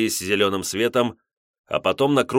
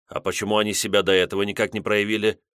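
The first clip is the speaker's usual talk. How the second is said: plain reading voice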